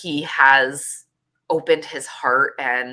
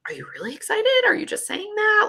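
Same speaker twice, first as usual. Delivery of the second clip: much higher, squeaky disbelief; disbelieving question. Retold or imitated. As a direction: squeal it like you can't believe him. Retold doubtful question to husband.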